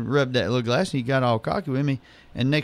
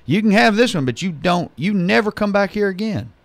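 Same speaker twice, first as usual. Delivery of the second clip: higher, louder angry retort; pissed-off bartender; 7 semitones higher, 4 dB louder than usual. Retold or imitated. Bartender kicks the customer out, angry and loud. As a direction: snap it like an annoyed barman. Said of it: "got a little pissed off".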